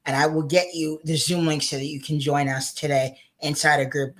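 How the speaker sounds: ordinary talk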